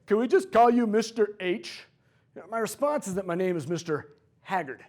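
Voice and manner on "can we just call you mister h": lightly voiced smart-aleck student, louder; a hint of a kid butting in on day one; mock cheeky kid. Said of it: "some idiot kid that interrupts".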